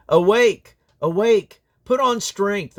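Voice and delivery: loud, high proclaiming call, repeated; two loud rising summons; ringing call. Acted. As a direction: cry out to rouse a sleeping people. Prophetic call to awake.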